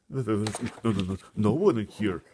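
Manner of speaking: nervous stammering giant, high. Acted. BFG lying to the giant, stammering. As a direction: stutter it out scared. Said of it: "he stammered".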